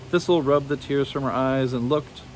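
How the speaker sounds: ordinary delivery, nothing marked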